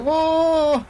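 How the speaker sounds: high, drawn-out mock whoa; imitated shock. Teller mimicking the stunned scam caller, mock shock.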